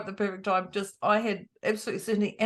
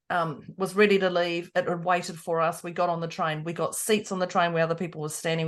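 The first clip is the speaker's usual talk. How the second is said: ordinary talk, nothing marked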